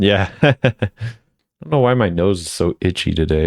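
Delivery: ordinary talk, nothing marked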